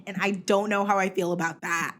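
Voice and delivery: ordinary talk, nothing marked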